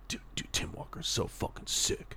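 hushed whisper, mock secret; whispered aside. Retelling Wes's excitement to S0, whispered. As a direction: lean in and whisper it.

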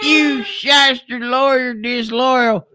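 high, slow hammed-up accusation; mock outraged shout. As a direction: drag out the accusation, high and hammy.

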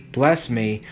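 ordinary delivery

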